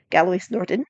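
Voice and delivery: ordinary delivery, nothing marked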